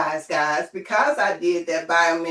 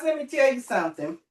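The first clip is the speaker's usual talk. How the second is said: usual speaking voice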